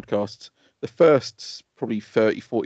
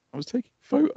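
nervous retold reply; 14 semitones higher, faster than usual. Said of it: "I was like".

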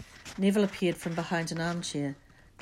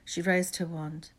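ordinary delivery

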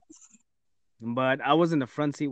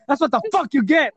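mom's retort; 10 semitones higher, 5 dB louder than usual. Retold or imitated. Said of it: "she's like".

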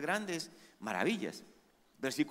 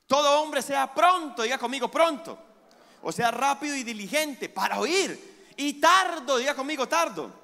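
preaching at full force; 6 semitones higher, 6 dB louder than usual. Acted. Pastor preaching to the congregation about God's voice, full force. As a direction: lift the congregation with the promise.

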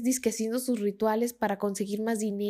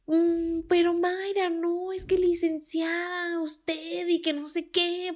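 higher, slow, syrupy multilevel-marketing pitch; mock the slow, pleading OmniLife seller, pitched up; pushy salesman voice; 7 semitones higher, slower than usual. Homero pleading with Mayra on the phone, mock salesman voice. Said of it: "tenía esa vocecita de vendedor de OmniLife".

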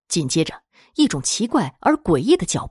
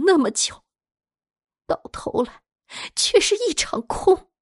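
anguished jealous daughter; 5 semitones higher than usual. Acted. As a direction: confess bitterly, whispering then crying out. Jealous daughter's despairing confession.

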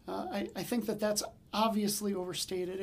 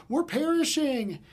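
hammed-up wail, higher and louder; mock melodrama; 6 semitones higher, 6 dB louder, slower than usual. Pastor mocking the disciples' panic, hammed-up wail. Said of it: "disciples take themselves too seriously".

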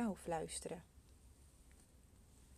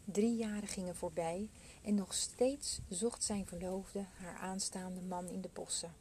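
ordinary delivery

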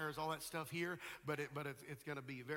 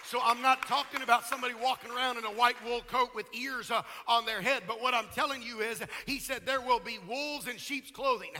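loud, driving pulpit delivery; full-force preaching; 7 semitones higher, 10 dB louder than usual. Real, not acted. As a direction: hammer key words and stretch them out.

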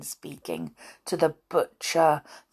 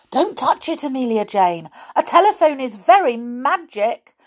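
anxious cry; 8 semitones higher, 6 dB louder than usual. Acted. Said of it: "cried Tom".

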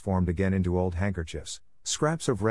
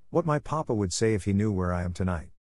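ordinary delivery, nothing marked

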